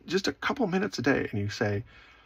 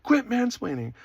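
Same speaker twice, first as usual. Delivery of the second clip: retold yell; 10 semitones higher than usual. Girlfriend snapping at boyfriend for mansplaining, mock yell. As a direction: imitate an indignant, high-pitched scolding. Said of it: "she yelled at me one time".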